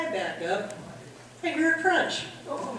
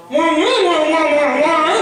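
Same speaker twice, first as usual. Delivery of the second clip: louder, higher wah wah for swear words; censored cursing; 4 semitones higher, 9 dB louder than usual. Retold or imitated. Woman replacing the husband's cursing rant, loud wah wah. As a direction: stand in for a rant of curses. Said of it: "I only swear so much".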